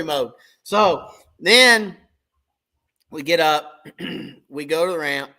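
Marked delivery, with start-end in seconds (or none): none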